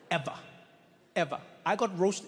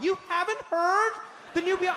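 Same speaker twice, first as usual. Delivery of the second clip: shrieked mock disbelief; 12 semitones higher than usual. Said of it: "they'll be like".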